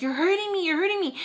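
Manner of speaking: high-pitched repeated protest; imitated pained complaint. Woman gripped by the arm, high mimicked complaint.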